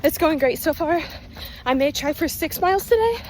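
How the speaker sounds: breathless talk mid-jog; panting between words on a run; while running. Real, not acted. Talking mid-run, measures raised.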